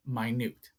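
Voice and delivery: "minute" as my-NOOT, said softly; careful pronunciation. Shown as a demonstration. Teacher naming which of the two words is meant, careful pronunciation. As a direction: say "minute" with long I and stressed NOOT. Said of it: "the pronunciation is different".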